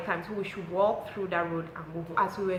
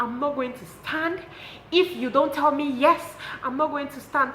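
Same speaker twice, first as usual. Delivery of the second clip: pleading; 8 semitones higher, 6 dB louder than usual. Retold or imitated. Boyfriend kneeling, begging for yes.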